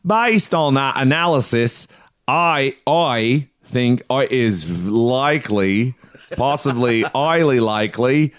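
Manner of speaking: exaggerated fake Australian with drawn-out words; bad Australian accent. Shown as a demonstration. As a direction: take another laborious swing at Australian. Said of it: "have one more swing at it".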